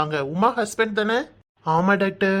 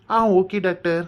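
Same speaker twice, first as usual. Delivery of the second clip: usual speaking voice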